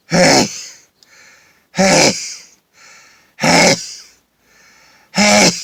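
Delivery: loud sneezes in a row; sneezing fit from pepper. Real, not acted. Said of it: "Bless me".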